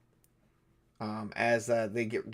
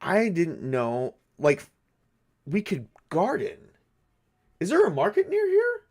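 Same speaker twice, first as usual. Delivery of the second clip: dawning idea, rising to an excited ask; sudden realization; 5 semitones higher than usual. Acted. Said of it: "He's like".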